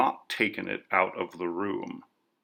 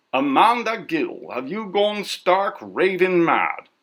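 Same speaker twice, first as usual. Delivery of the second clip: imagined scolding sister; 8 semitones higher, 4 dB louder than usual. A narrator's reading.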